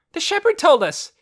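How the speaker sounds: loud high shout. A narrator's reading. Boy jumping up and down, shouting. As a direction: jump and yell it out. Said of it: "he shouted".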